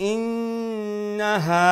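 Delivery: ইন্নাহা chanted slowly and high, long hum on nn, long final haa; slow sustained recitation of ইন্নাহা; ghunnah. Shown as a demonstration. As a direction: hold the nn, pass quickly over na, stretch haa in ইন্নাহা. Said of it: "ওখানে লিগে আপনার গুন্না".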